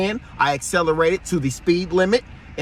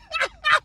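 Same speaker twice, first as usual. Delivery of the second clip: mocking laugh. Real, not acted. Laugh after insulting officer.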